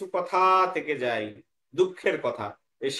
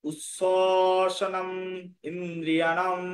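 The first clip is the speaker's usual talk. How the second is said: slow, sustained syllables; word-by-word teaching chant; broken into parts; much slower than usual. Shown as a demonstration. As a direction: recite it piece by piece, holding the vowels. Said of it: "ভেঙে ভেঙে নিলাম".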